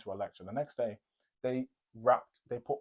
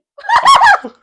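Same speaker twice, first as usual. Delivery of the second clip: loud burst of laughter; 25 dB louder than usual. Real, not acted. Laughing at neighbour's excuse.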